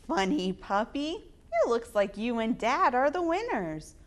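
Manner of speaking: ordinary delivery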